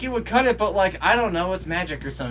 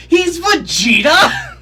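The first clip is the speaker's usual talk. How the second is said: slow, much higher, loud disbelieving yell; shocked shout; 13 semitones higher, 12 dB louder, slower than usual. Real, not acted. Viewer realizing the swordsman is Vegeta, shocked shout. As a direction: blurt it out in total disbelief. Recognizing the voice actor.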